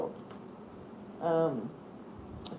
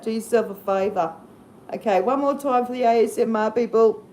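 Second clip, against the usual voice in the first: ordinary talk, nothing marked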